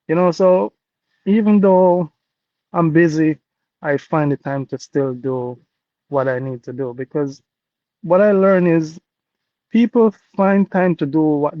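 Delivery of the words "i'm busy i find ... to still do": ordinary delivery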